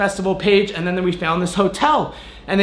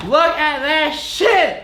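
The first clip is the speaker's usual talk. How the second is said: slow, high and thrilled; hyped; 7 semitones higher, much slower than usual. Real, not acted. Vlogger shows viewers his new shoes, slow and hyped. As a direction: savor every word, showing off the prize.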